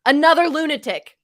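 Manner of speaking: loud, high, all-caps outburst; all-caps shout. Retold or imitated. Reading a hostile tweet to co-hosts, all-caps shout. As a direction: read it out in capital letters. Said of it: "That's in all caps".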